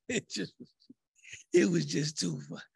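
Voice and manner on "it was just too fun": ordinary talk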